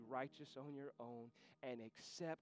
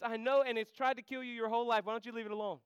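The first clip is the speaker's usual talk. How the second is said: full-force preaching; 10 semitones higher, 15 dB louder, faster than usual. Acted. Preacher crying out to the congregation to walk with God. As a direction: thunder it out to the congregation.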